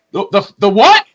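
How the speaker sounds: loud, stammered disbelief; acting out stunned disbelief; shocked son. Son shocked by funeral news.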